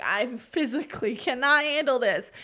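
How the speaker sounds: exasperated and punchy; overwhelmed protest. Retold or imitated. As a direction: quote yourself at breaking point, half-joking. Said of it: "and I was like".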